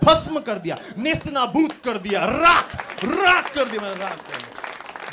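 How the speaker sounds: loud, high theatrical declaration; booming mock-dramatic proclamation; hammed-up triumphant shout. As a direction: roar it like an over-the-top victor. Dramatic boast of destroying everything.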